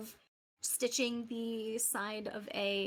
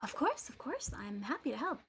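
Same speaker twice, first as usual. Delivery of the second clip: cheerful shopkeeper; 5 semitones higher than usual. Acted. GM voicing Adabra.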